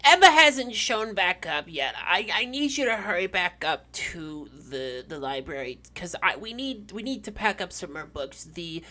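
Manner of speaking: put-on high, halting elderly voice; old lady. Old librarian phones Vincent for help, high halting voice. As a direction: dither and stammer like an elderly woman. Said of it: "it's the old lady. She goes".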